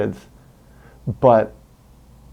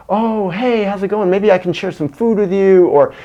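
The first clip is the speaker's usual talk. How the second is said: higher, friendly, gentle; 7 semitones higher than usual. Retold or imitated. Bystander calming an abusive parent, light gentle voice. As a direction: coax the parent kindly in a raised friendly voice. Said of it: "talk in a gentle voice to the parent".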